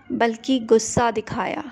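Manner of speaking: ordinary delivery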